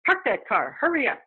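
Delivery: impatient mother rushing her daughter; pushy ghost voice. Retold or imitated. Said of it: "I hear a woman's voice going".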